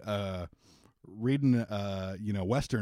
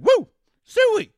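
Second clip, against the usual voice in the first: much higher, louder hog-call whoop; crowd whoop; 11 dB louder than usual. Acted. Crowd cheers the speech.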